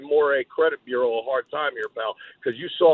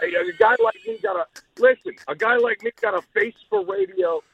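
high boastful promo; 4 dB louder than usual. Acted.

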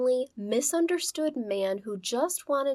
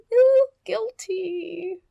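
very slow, high, loud whine; mock guilty wail; 10 semitones higher, 7 dB louder, much slower than usual. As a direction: wail the confession mockingly.

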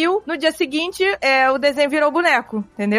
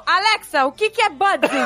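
asking Alexa; 7 semitones higher than usual. Retold or imitated. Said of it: "Aí ela perguntou".

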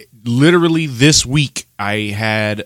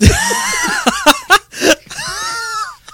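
big burst of laughter. Real, not acted. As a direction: crack up laughing hard. Group cracks up at joke.

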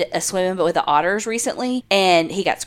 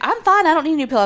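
imitating husband; 8 semitones higher, faster than usual. Wife imitates husband refusing new pillow, high mocking voice. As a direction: play the husband brushing it off, pitched way up. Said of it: "Colby was like".

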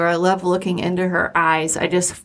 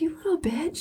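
higher, much softer retort to the horse; mock insult at horse; 9 semitones higher, 8 dB quieter than usual. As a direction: toss a high, hushed insult at the horse. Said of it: "I'm like".

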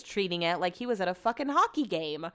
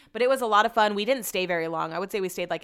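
usual speaking voice

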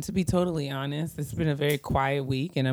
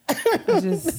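laughing; 12 semitones higher, 4 dB louder than usual. Real, not acted. Both laughing about TV hiatuses.

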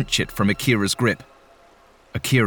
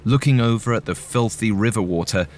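plain reading voice

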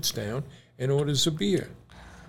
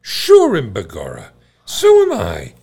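put-on Irish accent; 7 dB louder than usual. Irish bar joke.

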